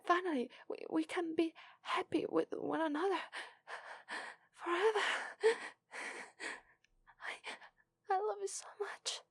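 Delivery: high, breathless happy giggles between words; laughing through happy tears; giddy laughing joy. Acted. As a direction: gush with flustered delight. Giddy after hearing love returned.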